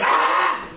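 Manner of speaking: acted cry of banging into a wall; rough, exasperated "ah"; hammed-up yell of self-punishment; mock frustrated yell. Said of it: "hitting yourself against the wall".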